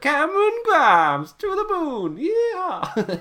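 high, whooping Southern cowboy holler; cartoon Southern cowboy. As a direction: do an over-the-top Southern cowboy, drawn out. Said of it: "he's such a cartoon Southern cowboy guy".

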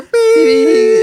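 high, loud held beep like a TV censor tone; mock censor bleep. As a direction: imitate the bleep that covers a swear word. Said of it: "there's just gonna be one long ass bleep".

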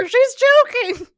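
high, loud, stretched-out cry; mock panic. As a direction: imitate an over-the-top rescuer. Re-enacting the choking panic.

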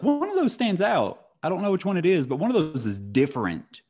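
squeaky, high mock voice; high-pitched quote. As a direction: pipe it up high and puzzled.

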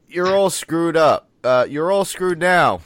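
loud, rushed movie line; loud quote. Retold or imitated. Podcast host blurting the Hulk's line, loud. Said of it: "I'm sorry, I ruined that".

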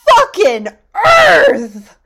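high, slow, strained yell; anguished shout. Retold or imitated. Jet storms off yelling at the scientist, anguished shout. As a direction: roar it out in pure frustration. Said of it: "And Jet goes".

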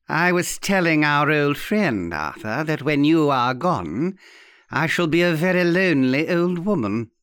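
put-on plaintive, theatrical old lady; arch old aunt. Aunt telling Arthur she'll be lonely, archly plaintive. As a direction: repeat it pointedly for the nephew. Aunt's voice in the story.

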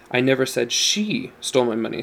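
single out "she" in the middle; stress on SHE. Shown as a demonstration. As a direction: put the weight on "she". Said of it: "depending on the emphasis you give".

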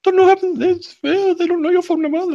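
very high falsetto mimicry; mocking child imitation. Host echoing the child's relief, mocking falsetto. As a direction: parrot the kid back in a squeaky falsetto.

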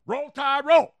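loud, high rallying cheer; team cheer. Acted. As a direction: holler it like a fan in the stands. Calls out the team's cheer.

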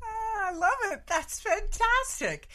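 delighted. Real, not acted. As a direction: gush over the story's twist. Host reacts to the story.

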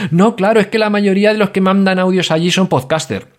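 a hammed-up complaining listener; mock listener excuse. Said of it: "Porque dice".

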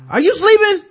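very high, loud mock yell; mock angry wake-up. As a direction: snap it at a sleeping kid. Voicing abuser waking child.

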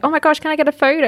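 high, quick fan request; starstruck gushing. Retold or imitated. Fan asking the Real Housewives for a photo, excited. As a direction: gush as you retell asking a celebrity.

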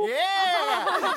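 high-pitched giggling fit; high laughter. Acted. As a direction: laugh like being tickled. Jack tickled, laughing.

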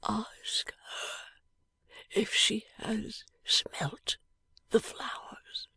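barely voiced, dying breath; a dying man's faint whisper. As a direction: whisper it weakly from a sickbed.

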